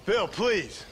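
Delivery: put-on urgent pleading with an old friend; urgent plea. Man begging Bill to accept help, pleading urgently. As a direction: plead to be heard. Pleading with armed former brother.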